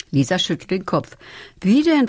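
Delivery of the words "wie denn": put-on higher and louder worried child's question; unsure. A narrator's reading. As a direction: ask as a little girl who doubts it will work. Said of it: "fragt sie unsicher".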